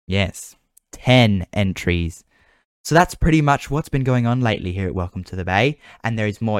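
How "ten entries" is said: "ten" drawn out, slow and emphatic; stress on TEN. Real, not acted. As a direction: linger on "ten entries" very slowly. Repeating prize for emphasis.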